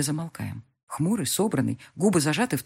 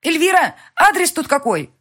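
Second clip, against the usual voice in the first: higher, louder frantic call; yelling; 12 semitones higher, 9 dB louder than usual. A narrator's reading. Heroine yelling at Elvira for the address, frantic. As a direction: yell the question across the room. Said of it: "мои вопли".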